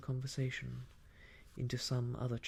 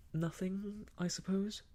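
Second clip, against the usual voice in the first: higher, hesitant admission; uncomfortable; 8 semitones higher than usual. A narrator's reading. Young man admitting he has no plans, uncomfortable. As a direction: answer uneasily, pitched up. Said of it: "beginning to feel very uncomfortable".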